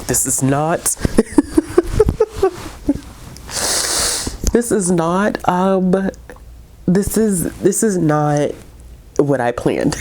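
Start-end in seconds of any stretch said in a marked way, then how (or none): 0.8-4.5 high breathless laugh through tears; tearful laugh. Real, not acted. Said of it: "I'm going to continue to cry". 4.5-10.0 higher, fighting back tears; choked up. Real, not acted. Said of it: "I did not wanna get emotional".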